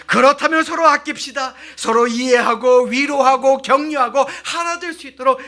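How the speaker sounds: rally the congregation to love one another; loud, impassioned call to the church; fervent preaching. Acted. Preaching at full force.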